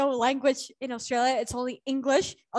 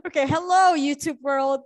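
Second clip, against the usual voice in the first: loud, higher hello to an audience; big, projected video-intro hello; calling out a greeting; 3 semitones higher, 7 dB louder than usual. Real, not acted. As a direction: greet the viewers in a raised voice. Teacher addresses the YouTube audience.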